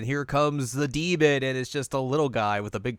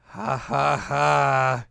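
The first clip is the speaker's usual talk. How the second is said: nervous fake laugh; 3 semitones higher, 5 dB louder, slower than usual. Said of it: "And he's like".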